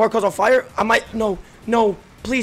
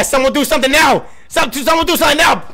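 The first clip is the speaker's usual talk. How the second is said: raised, higher-pitched shout for action; urgent demand; 5 semitones higher, 4 dB louder than usual. Real, not acted. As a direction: yell for someone to act now. Demanding action from other players.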